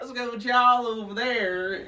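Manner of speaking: slow, high-pitched mock Southern drawl. Imitating down south people for a friend, exaggerated Southern drawl. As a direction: drag the words out like a Southerner, half laughing. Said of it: "down south people ... they be dragging words".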